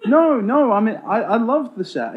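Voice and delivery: high, loud insistent denial; emphatic protest. Real, not acted. Interviewer hastily reassuring the band he liked their set, emphatic. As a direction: jump in loudly to take it back. Hurriedly backpedalling after a jibe.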